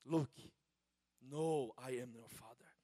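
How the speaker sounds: lightly voiced deep Darth Vader quote; a hint of Vader, dropping low at the end. Preacher quoting a film villain, lightly imitated Darth Vader. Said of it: "Existem vozes Darth Vader".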